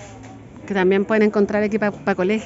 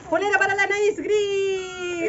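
excited; 6 semitones higher, 4 dB quieter, much slower than usual. Real, not acted. Daughter cheers gift.

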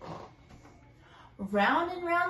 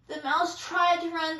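usual speaking voice

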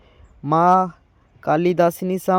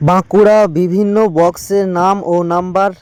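louder, punchy video title call-out; title announcement; 6 dB louder than usual. Acted. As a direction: call out the title again to open the list. Title repeated before the list. (জোরে ভিডিওর শিরোনাম আবার ঘোষণা)

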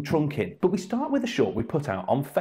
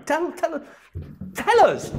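building to a much higher, louder mock plea; mock-desperate demand; 12 semitones higher, 4 dB louder, slower than usual. Host demands Tony explain himself, a hammed-up rising shout.

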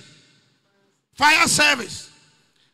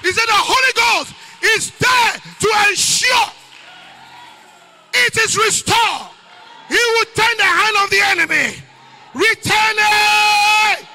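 preaching at full force; 8 semitones higher, 6 dB louder than usual. Acted. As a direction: shout the victory over the congregation. Preacher's closing climax.

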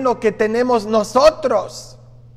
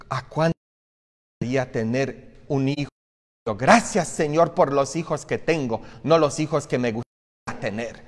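softer, hushed thanks to God, a little quicker; hushed grateful prayer; 6 semitones lower, 7 dB quieter, faster than usual. Pretend. Preacher voicing a parent quietly thanking God for their children.

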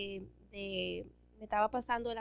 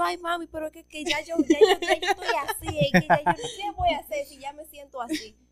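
high, loud mock whimper breaking into a laugh; mock whining; 5 semitones higher, 7 dB louder than usual. Pleads with mom about loving the truck, mock whining. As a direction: plead like a crying child, half laughing. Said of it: "Y yo".

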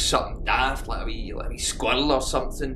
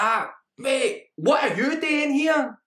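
much higher, slow and startled; 12 semitones higher, slower than usual. Acted. Wee guy caught out by his mate, high and startled. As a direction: squeak it out, caught in the act.

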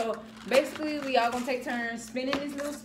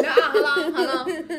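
laughing; 4 semitones higher, 7 dB louder, slower than usual. Real, not acted. Laughing at the flavor.

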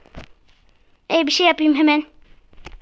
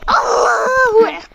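slow, high yelled war cry; battle cry shout; 7 semitones higher, 3 dB louder than usual. Real, not acted. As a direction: shout it out as you attack.

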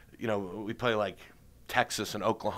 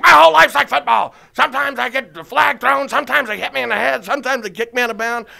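much higher, loud, manic pitch; Gary Busey impression; 12 semitones higher, 11 dB louder than usual. Busey pitching a football date to Toby, high and loud. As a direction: pitch it like a man with wild plans. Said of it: "And he's like".

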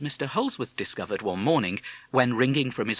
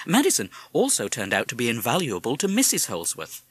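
ordinary delivery

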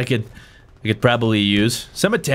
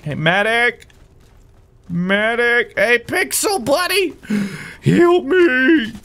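man down shout; 11 semitones higher, slower than usual. Real, not acted. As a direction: yell for help. Calling medic while hurt.

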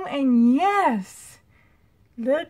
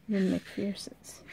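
slow hushed whisper; 3 semitones lower, 9 dB quieter, slower than usual. Real, not acted. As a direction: drop to a whisper. Whispered comparison.

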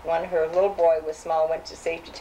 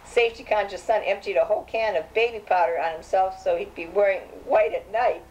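ordinary talk, nothing marked

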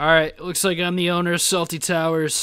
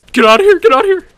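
loud, high-pitched panicked shout; panicked yell; 14 dB louder, much faster than usual. Real, not acted. Gamer yelling at an enemy player, panicked yell. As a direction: yell to drive off an attacker.

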